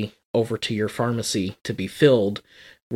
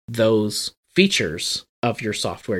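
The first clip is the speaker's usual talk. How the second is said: normal talking voice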